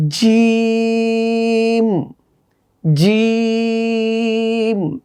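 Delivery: জিম stretched very long on one even tone; long drawn-out letter name. Shown as a demonstration. Teacher showing kids how to say জিম, held long and even. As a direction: hold জিম out slowly and evenly, like a chant. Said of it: "এভাবে আমাদেরকে হরফের নামগুলো বলতে হবে".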